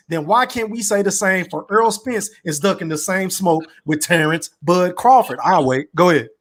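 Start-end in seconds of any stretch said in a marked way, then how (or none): none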